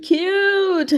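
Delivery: high squealed cute. Real, not acted. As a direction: squeal it with delight.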